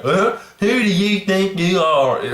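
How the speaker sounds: mocking George Bush's Texas drawl with a huffy "Huh?". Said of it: "That stupid Texas drawl that he has".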